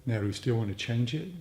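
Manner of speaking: calm teacher easing them into stillness; guided meditation. Acted. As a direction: guide the group into letting go. Leads a meditation.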